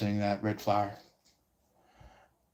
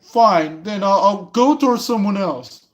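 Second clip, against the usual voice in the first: higher, louder huff; huffy give-up; 8 semitones higher, 5 dB louder than usual. Retold or imitated. Rejected flirt huffing off to someone else. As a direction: toss it off in a sulky huff. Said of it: "this person's like".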